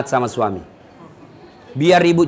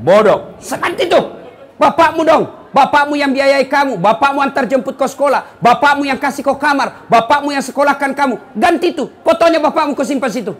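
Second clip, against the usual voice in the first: put-on loud, high scolding of a foolish girl; scolding lecture; 6 semitones higher, 3 dB louder than usual. Preacher berating a schoolgirl over her phone wallpaper, loud and scolding. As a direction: berate her, building to a laugh.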